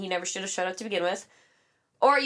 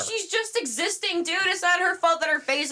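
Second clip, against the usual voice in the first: incredulous; 7 semitones higher than usual. Real, not acted. Repeats the defence.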